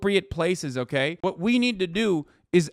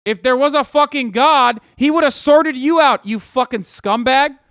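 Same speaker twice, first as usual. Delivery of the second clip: yelling in real rage, much higher and louder; furious shout; 7 semitones higher, 8 dB louder than usual. Real, not acted. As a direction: blast them with fury. Furious rant at legislators.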